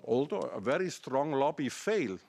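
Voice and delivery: normal talking voice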